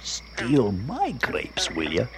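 very low, slow menacing growl to himself. Acted. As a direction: plot revenge in a deep, drawn-out voice. Lying in wait, threatening the thief.

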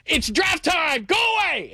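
high pitched shout brushing her off; mock yell. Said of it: "And I go".

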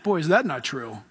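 ordinary talk

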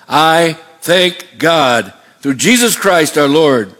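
very slow, drawn-out declaration; slow, emphatic quoted outcry; crying out in thanks. Retold or imitated. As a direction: stretch each word as a quoted cry of thanks. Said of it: "Then he cries out".

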